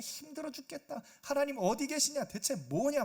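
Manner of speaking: whiny grumbling complaint, a touch mocking; complaining groan. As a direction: grumble at God like a tired Israelite. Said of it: "막 불평하고 있는 거예요". (투덜대며 불평하는 말투)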